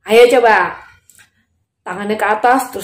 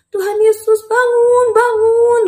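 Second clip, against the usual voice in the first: high, urgent calling; pleading high-pitched wake-up cry; urgent waking call; 12 semitones higher, 3 dB louder, slower than usual. Acted. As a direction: call out to rouse a sleeper. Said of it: "Didorong-dorong badannya".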